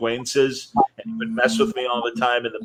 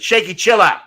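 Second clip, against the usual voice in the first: higher, emphatic mock scolding; imitating irritated broadcaster; 7 semitones higher, 4 dB louder than usual. Broadcaster telling the host to chill, mock-irritated. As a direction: tell him off in a put-on voice. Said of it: "He's like".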